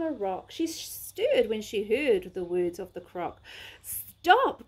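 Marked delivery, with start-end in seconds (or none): 3.8-4.7 cried out stop. Acted. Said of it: "cried Lizzie".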